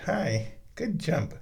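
high, slow gentle praise; slow cooing praise; soft pet praise. Real, not acted. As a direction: coo a little praise. Praising the cat softly.

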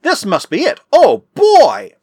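high exclamation swelling to a loud cry; wildly excited. A narrator's reading. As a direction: burst out in high-pitched excitement. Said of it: "Mark Sampson was wildly excited".

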